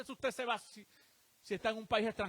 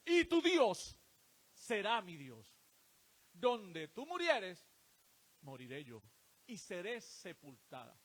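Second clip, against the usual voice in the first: solemn scripture reading; 5 dB louder than usual. Acted. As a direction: read the vow like a sacred oath. Preacher reads Ruth's vow.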